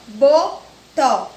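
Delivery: "botó" loud and slow as bo, pause, tó; split into syllables. Shown as a demonstration. Teacher giving pupils an example word, split into syllables. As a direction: break "botó" into two punched beats. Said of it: "de acordo com a quantidade de sílabas".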